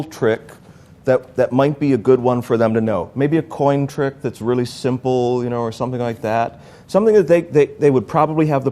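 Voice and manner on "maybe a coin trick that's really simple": normal talking voice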